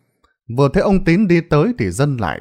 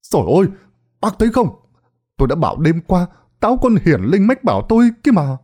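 raised, high I-told-you voice; loud boasting; 6 semitones higher, faster than usual. Acted. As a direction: call out to the crowd, a bit high. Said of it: "nói lớn". (nói lớn, giọng cao)

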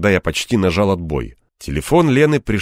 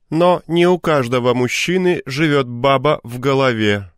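higher voice mimicking her snideness; snide echo; 6 semitones higher than usual. A narrator's reading. Man mimics the woman in his head, snide. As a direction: throw her sarcastic tone back at her. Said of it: "в тон её ехидству сказал я таким же голосом".

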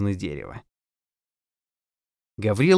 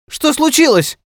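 high, loud cry of alarm; alarmed exclamation; 8 dB louder than usual. A narrator's reading. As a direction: call out sharply, pitched way up. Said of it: "воскликнула Гаврилова".

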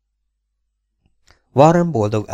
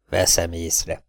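angry grumble; 2 semitones lower, slower than usual. A narrator's reading. Old cowboy grumbles back at his captor, mildly angry. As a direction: grumble it under your breath, sulking. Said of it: "dörmögte az öreg dühösen".

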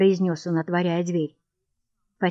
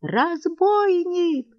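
much higher, slow wail; wife howls in outrage; 11 semitones higher, much slower than usual. A narrator's reading. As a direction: wail it as an accusation. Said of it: "завопила Анна Андреевна".

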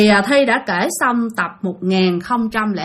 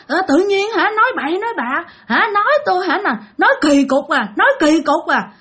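much higher, odd sulky voice of a possessed man; strange sullen reply; 7 semitones higher than usual. Retold or imitated. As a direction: sound like someone else speaking through him. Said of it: "trả lời kỳ lắm, mặt lầm lì".

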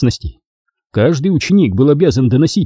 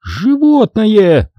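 disgusted; 10 semitones higher, much slower than usual. A narrator's reading. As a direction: spit it out in horror. Obin disgusted at pet.

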